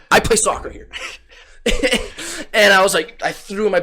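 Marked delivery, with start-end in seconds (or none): 0.1-0.9 mock indignant. 1.6-2.5 laugh. Real, not acted. Laughs after the line.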